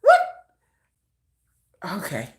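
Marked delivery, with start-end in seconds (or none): 0.0-0.2 pitched up high, incredulous; high, disbelieving reply; incredulous retort. Retold or imitated. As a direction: act baffled at the fuss, up high. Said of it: "I'm like".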